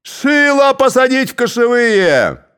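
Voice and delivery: loud high brawling yell; rowdy shout. A narrator's reading. Cossacks arguing over Shilo, shouting. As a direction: shout back and forth.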